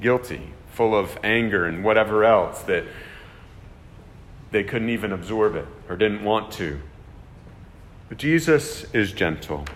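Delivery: ordinary delivery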